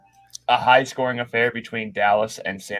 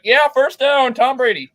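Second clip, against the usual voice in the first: mocking refs; 11 semitones higher than usual. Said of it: "They're like".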